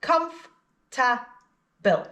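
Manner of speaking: very slow, "Comf-ta-ble" in three separate beats; syllable by syllable. Shown as a demonstration. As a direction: stretch out comf, ta, ble one by one. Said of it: "Three syllables".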